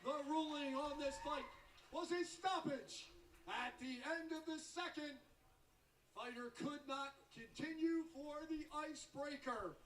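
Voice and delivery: drawn-out booming winner call; boxing ring announcer. Acted. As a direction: stretch the name out to the crowd. Announcer reads the result.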